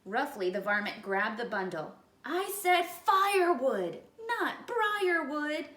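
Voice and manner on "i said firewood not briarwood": loud, high, slow pained yelp. Acted. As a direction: yelp it out with thorns in your paws. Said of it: "he yelped".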